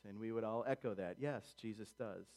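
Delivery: normal talking voice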